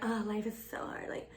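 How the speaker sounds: hushed, sighing fake self-pity; put-on exhausted lament; mock woe. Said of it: "to brag about and be like".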